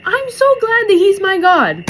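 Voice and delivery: high, loud, overcome with gladness; emotional exclamation. Real, not acted. Moved viewer echoes the girl, loud emotional exclamation. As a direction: belt it out with heartfelt force, pitched high. Moved, repeats the girl's words herself.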